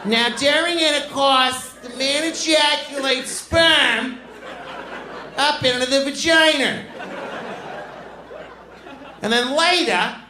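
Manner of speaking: put-on very high, drawn-out Boston accent; Boston teacher. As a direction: lecture slowly in a high Boston schoolmarm voice. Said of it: "they teach you to talk like that".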